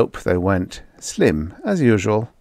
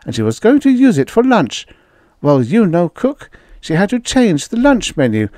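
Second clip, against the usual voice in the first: high, worried housewife voice; a wife upset about the kitchen; anxious high-pitched wife; fretting wife; 9 semitones higher, 4 dB louder than usual. A narrator's reading. Wife character voice.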